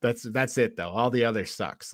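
normal talking voice

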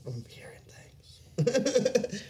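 slow, breathy whisper; whispered joke. Real, not acted. Laughing off a mistake with a friend: slow whisper. Jokes about hearing things.

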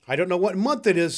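high, loud excited confession; giddy bewilderment. Acted. Scrooge back from the spirits, giddily bewildered. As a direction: exclaim it, happily lost.

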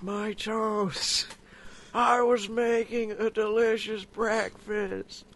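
slow, drawn-out celebrity impression; Owen Wilson. Cooking roleplay about burnt toast, Owen Wilson impression. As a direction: drawl it out, taking your time. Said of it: "he really turns into Owen Wilson".

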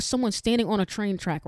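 ordinary delivery

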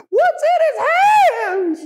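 very high, slow, frightened cop's cry; a panicked policeman shouting at a suspect; scared officer. Retold or imitated. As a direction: let the fear crack through, drawn out. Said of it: "I hear an Esau officer who's scared".

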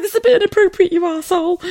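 high squealing laugh through the words; laughing retort. Retold or imitated. Telling off her husband, high laughing retort. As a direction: laugh it out at him.

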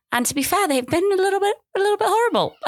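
high-pitched, sheepish confession; squeaky admission. Real, not acted. Mother admitting her kids were horrible, squeaked high. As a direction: squeak it out guiltily.

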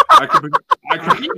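breaking into high laughter; squeaky laugh through words. Real, not acted.

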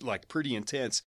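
ordinary delivery

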